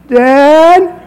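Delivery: lightly voiced daughter, pitch up; a hint of a worried daughter calling Dad.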